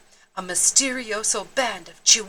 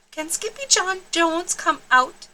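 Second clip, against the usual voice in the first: put-on high, hopeful child voice; lightly voiced little girl; little sister asking; 7 semitones higher than usual. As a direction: ask mom sweetly. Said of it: "asked Jujubee".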